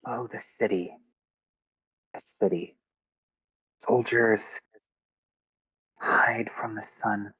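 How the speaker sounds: hushed, half-whispered pondering; thinking aloud. Acted. Stella puzzles over the message, murmuring to herself. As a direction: murmur the clue in broken pieces. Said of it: "she starts thinking".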